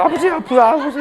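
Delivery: high, hammed-up whimper, laughing through it; mock whimpering. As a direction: parody a crying son. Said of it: "이러면서 울고 있어". (웃음 섞인 과장된 흐느낌 흉내)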